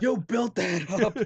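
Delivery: high, slow hammed-up outrage; mock cursing out. Playing along as the let-down friend, mock outraged. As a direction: accuse him in drawn-out mock fury. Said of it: "picture you cursing me out later".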